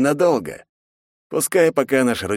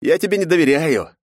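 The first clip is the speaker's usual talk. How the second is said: spiteful smirk; 7 semitones higher than usual. A narrator's reading. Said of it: "злобно усмехнувшись".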